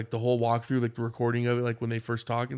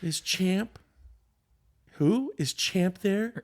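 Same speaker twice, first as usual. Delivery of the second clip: higher, slow drawn-out question; hammed-up caller, high and drawn out; mock prank caller; 8 semitones higher, much slower than usual. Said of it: "he goes".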